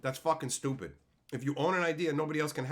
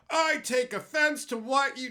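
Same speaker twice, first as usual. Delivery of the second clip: high, loud, slow whine; hammed-up offense; mocking offended person; 9 semitones higher, 6 dB louder, slower than usual. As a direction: parody a touchy complainer. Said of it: "So obnoxious".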